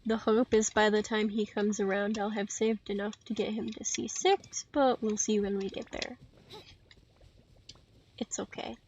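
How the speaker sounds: ordinary delivery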